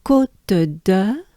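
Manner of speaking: "Côte de" as careful koht-duh, rising; French name demo. Shown as a demonstration. Teacher reminding learners, careful rising French "Côte de". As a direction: say "Côte de" syllable by syllable, lifting the last.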